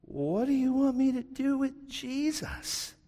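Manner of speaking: Pilate asking. Acted. Pilate asking the silenced crowd, high and slow. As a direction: call out over the hushed crowd.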